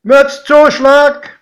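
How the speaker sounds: loud, high, drawn-out station announcement; conductor's call. A narrator's reading. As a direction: sing out the stop like a train conductor. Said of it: "rief der Schaffner". (lauter, gedehnter Schaffnerruf)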